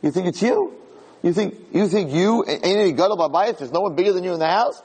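high, incredulous "Really?" then sharp scolding; God scoffing at a boastful man; mocking challenge. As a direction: needle the braggart with disbelief. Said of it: "HaKadosh Baruch Hu says".

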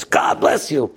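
imitating a fired-up minister; evangelical preacher. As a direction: proclaim it with growing zeal. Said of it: "Moshe's getting stronger".

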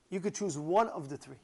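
ordinary delivery, nothing marked

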